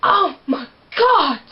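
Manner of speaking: loud, high, slow tearful gasp; overacted crying exclamation; mock shocked cry. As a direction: ham up the teary shock. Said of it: "And then she's like".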